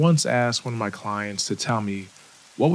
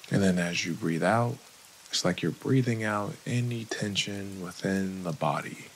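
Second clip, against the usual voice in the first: calm, unhurried meditation guide, long pauses; guided meditation; 2 semitones lower, 4 dB quieter than usual. Acted. Therapist guiding listeners through breathing and a body scan, calm meditation voice. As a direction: ease the listener into relaxing, leaving room to breathe.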